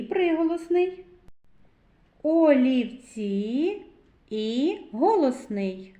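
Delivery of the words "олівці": олівці slow and louder, vowels held long; drawn-out sounding-out. Shown as a demonstration. Teacher leading children to the vowel і, slowly drawn out. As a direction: stretch олівці out, rising at the end. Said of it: "звуковий аналіз слова олівці".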